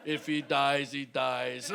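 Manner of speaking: low, flat, deadpan Russian heavy; cold boxer's verdict, deep and quiet; Ivan Drago. Retold or imitated. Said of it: "looking at me like Ivan Drago".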